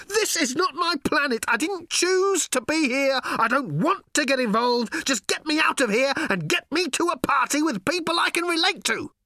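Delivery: high, loud frantic yell; yelling while running. Acted. Ford yelling as he runs from robots, frantic high yell. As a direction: shout it mid-sprint. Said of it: "he yelled as he ran".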